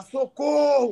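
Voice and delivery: imitated shout for help; mock cry for help. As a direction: call out for rescue, half-acted. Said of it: "você quer gritar".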